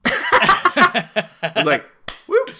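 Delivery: laughing. Real, not acted. Laughing retelling of cheer.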